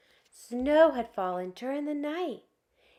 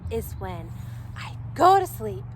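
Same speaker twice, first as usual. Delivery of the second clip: plain reading voice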